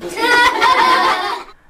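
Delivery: laugh. Real, not acted. Teacher laughs at picture joke.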